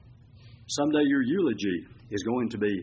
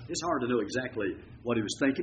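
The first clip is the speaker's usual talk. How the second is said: ordinary delivery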